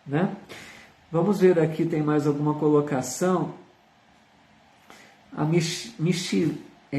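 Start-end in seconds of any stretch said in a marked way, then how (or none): none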